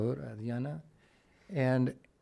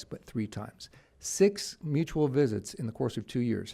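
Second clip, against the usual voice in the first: ordinary talk, nothing marked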